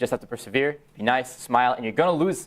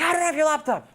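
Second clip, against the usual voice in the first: denying thief. Retold or imitated. Thief denies it.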